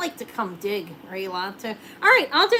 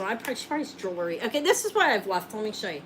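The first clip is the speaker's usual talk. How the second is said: usual speaking voice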